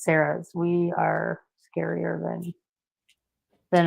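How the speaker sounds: usual speaking voice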